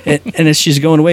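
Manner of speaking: ordinary talk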